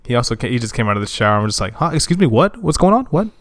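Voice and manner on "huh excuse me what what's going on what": high-pitched, challenging rapid questions; confrontational. Retold or imitated. Imitating his housemate challenging the neighbor, confrontational. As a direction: demand answers, voice jumping up. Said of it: "real, like, confrontational".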